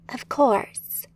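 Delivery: whispered reply. A narrator's reading. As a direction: breathe it in a whisper. Quick reply during escape.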